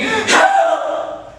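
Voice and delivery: high, loud cry for help; desperate scream. Acted. Son finding dying parents, screaming for help. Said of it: "and I screamed".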